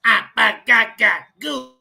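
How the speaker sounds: loud mock chant of Abba Yah; mocking the Apostle. Mocking how the Apostle speaks, loud chant. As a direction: ham up gibberish chanting. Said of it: "don't nobody speak like that".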